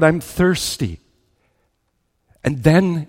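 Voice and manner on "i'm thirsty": ordinary talk, nothing marked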